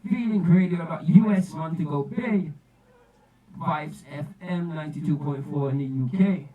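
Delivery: loud shouted dancehall DJ toast; radio DJ hype. Acted. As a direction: hype the crowd like a live sound-system DJ. Radio DJ hyping the artist.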